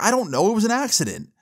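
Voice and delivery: high-pitched mock pleading; retold teen whine of innocence; defensive protest. Teen defending himself to mom over the spill, defensive protest.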